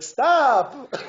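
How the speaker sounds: high, loud laughing squeal; giggly child. Retold or imitated. Father imitating his toddler laughing, high and loud. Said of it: "He was like".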